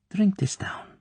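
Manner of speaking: a high, breathy coaxing whisper; oily whisper. Acted. Klebb urging Tatiana to drink the champagne, in a whisper. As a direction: hush it out, sweetly pushing the drink. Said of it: "The voice became oily and smooth".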